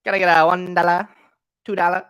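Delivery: pushy auctioneer patter; hard sell like a car lot dealer; used car salesman. Acted. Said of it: "sound like a used car salesman".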